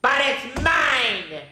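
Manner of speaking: slow pouty bird protest; mock scream. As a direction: drag out an indignant squawk. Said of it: "screamed the bird".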